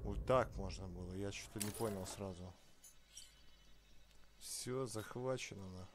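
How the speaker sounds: usual speaking voice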